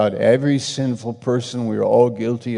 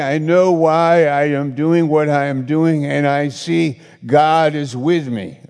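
raised, higher preaching voice; sermon climax, loud and high; fiery preaching; 5 semitones higher, 6 dB louder than usual. Acted. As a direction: preach it out with full conviction. Preaching at full force.

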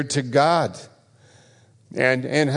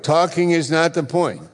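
higher, insistent preaching; raised pitch, driving the point home; emphatic refrain; 6 semitones higher than usual. Real, not acted. Preacher hammering home his refrain.

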